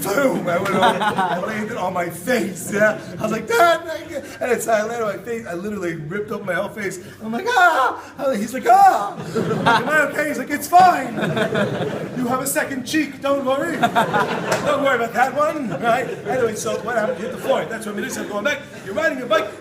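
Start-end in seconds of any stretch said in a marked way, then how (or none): 3.5-4.2 very high loud sarcastic thanks; dripping sarcasm; mock thanks. Son to dad after crashing, high loud sarcastic thanks. 7.5-10.3 very high loud laughing scream; hammed-up panic shriek. Kid and dad after the crash, laughing screams. 10.5-15.7 high loud laughing, slowing at end; joking reassurance. Retold or imitated. Dad to injured son, high laughing reassurance.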